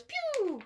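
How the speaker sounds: squeaky high arrow pew. A narrator's reading. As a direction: zap it out high. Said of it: "He goes".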